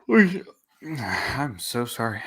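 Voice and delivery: talking through a yawn. Real, not acted. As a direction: yawn while you speak. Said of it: "I'm yawning".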